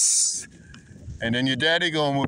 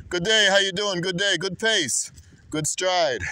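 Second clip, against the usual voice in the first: high, bright call to a passerby; upbeat hello called out from a bike; cheery greeting; 8 semitones higher than usual. Real, not acted. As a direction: greet a jogger with enthusiasm. Greeting passerby while cycling.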